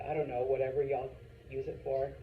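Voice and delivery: ordinary delivery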